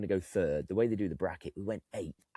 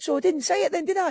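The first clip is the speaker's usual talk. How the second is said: triumphant; 9 dB louder, faster than usual. Retold or imitated. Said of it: "I said".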